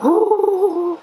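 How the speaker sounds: sharp, high gasp of delight. Real, not acted. Booktuber gasps at a gorgeous book cover, delighted. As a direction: gasp like the cover just stunned you.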